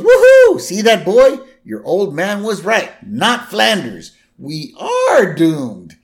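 very high, loud mock triumph; Homer gloating. Retelling a father gloating to his boy, hammed-up triumph. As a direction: crow it like a gleeful cartoon winner. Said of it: "And then Homer goes".

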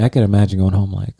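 normal talking voice

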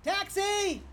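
high calling shout; hailing a taxi. Acted. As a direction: call out for a cab. Hailing a cab on street.

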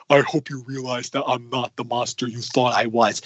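low, intense Adam Driver take. Retold or imitated. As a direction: drop into a brooding dying villain. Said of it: "do my best Adam Driver voice".

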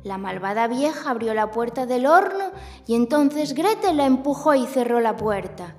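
usual speaking voice

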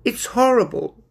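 high, upset cat voice; hurt small voice; sad little cat. Acted. Binnicky sad alone.

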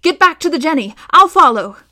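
high, loud urgent shout. A narrator's reading. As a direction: yell to send them running. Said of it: "she shouted at Hester and Tom".

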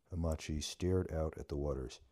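ordinary delivery, nothing marked

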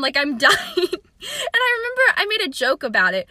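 amused retelling, laughter bubbling through the words; laughing while talking. Real, not acted. Laughs retelling her own panic.

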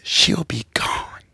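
slow unvoiced whisper. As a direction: breathe it slowly, knowingly. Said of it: "My pastor said".

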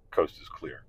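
conspiratorial whisper; whispering the all-clear to his family. Retold or imitated. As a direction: hush it like the visitors might hear. Sneaking after guests leave.

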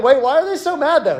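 high-pitched puzzled protest; acted bafflement. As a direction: play a confused onlooker, pitch lifted. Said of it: "You're like".

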